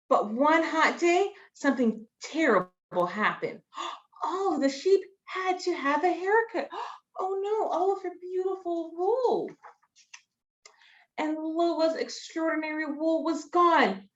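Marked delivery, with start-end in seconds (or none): 3.7-9.5 high gasping dismay; mock horror for kids; gasping shock. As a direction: gasp as if it's a catastrophe. Gasps at shocking haircut.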